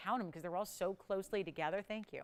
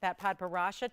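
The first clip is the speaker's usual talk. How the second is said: ordinary delivery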